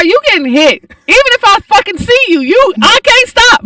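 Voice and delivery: high, loud exasperated warning climbing to a yell; worked-up comic rant. Acted. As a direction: build to a hammed-up shout at pedestrians. Comic rant at freeway pedestrians.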